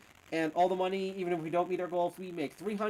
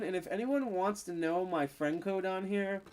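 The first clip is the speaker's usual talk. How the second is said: ordinary talk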